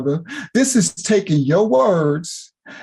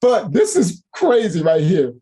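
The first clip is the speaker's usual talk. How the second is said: ordinary talk, nothing marked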